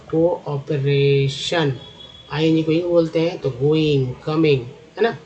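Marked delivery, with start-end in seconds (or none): 0.1-1.7 cooperation slowly, syllable by syllable; teacher piecing cooperation together slowly; sounding out. Shown as a demonstration. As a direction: stretch out each part of cooperation. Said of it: "सीओ को बोलते हैं".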